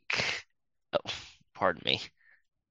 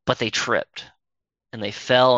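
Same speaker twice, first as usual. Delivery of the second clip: ordinary delivery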